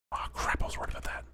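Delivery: worried whisper. Real, not acted. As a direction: whisper the fear under your breath. Hiding from a zombie.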